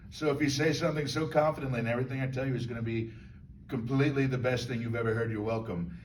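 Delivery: lightly voiced, fast, utterly sure of himself; a hint of a man who's never wrong; rattled off with mild overconfidence; smug know-it-all. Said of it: "it's a soothing voice, he's never wrong in his mind".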